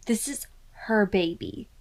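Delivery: ordinary delivery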